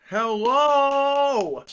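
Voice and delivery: loud, high drawn-out hello; calling out. Real, not acted. Frozen camera, calling out to listeners, loud. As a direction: shout hello into the frozen stream.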